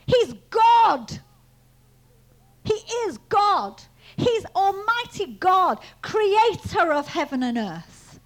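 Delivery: loud, high, hammered declaration; retold rebuke rising into full-voiced preaching; emphatic proclamation. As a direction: proclaim it slowly and forcefully, like a preacher.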